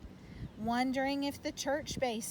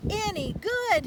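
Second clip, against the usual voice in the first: incredulous echo; 11 semitones higher, 8 dB louder than usual. Acted. Papa echoes son's question, incredulous.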